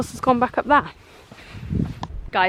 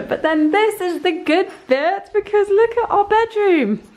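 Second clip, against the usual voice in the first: higher, bright building excitement; excited reveal; 10 semitones higher than usual. Real, not acted. Vlogger revealing the bedroom to viewers, excited and higher. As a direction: unveil the best bit with a lift.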